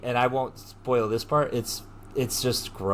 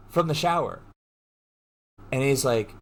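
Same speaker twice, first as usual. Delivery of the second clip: ordinary talk, nothing marked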